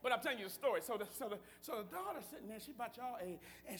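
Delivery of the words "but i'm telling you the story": usual speaking voice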